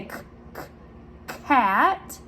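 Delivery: CAT led in by two separate K sounds; k sound demo. Shown as a demonstration. Teacher has children say it with her, K sounds isolated before CAT. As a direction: say a lone K twice, pause, then "cat". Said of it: "Starts with the k sound".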